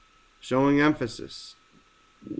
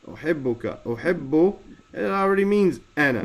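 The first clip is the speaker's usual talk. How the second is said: usual speaking voice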